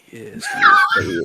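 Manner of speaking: laughing shout. Pretend. Insisting to friends he is Mister Fuji, laughing shout. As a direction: shout yes yes while cracking up.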